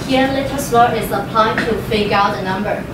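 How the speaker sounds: ordinary talk, nothing marked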